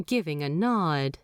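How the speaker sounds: ordinary delivery, nothing marked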